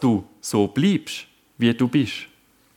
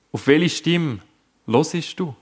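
ordinary talk

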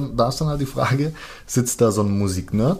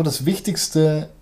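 ordinary talk, nothing marked

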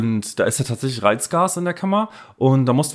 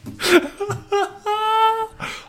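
high shrieking laughter. Real, not acted. Reacts to card text.

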